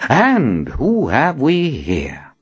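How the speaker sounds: put-on slow, curious old prophet; curious man. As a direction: ask it with interest. Nathan curious.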